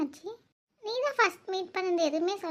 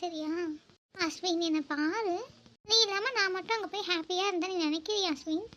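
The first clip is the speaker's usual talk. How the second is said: soft, hurt pleading; gently imploring an angry partner; pleading apology; 3 dB quieter than usual. Acted. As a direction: beg softly to be forgiven and understood.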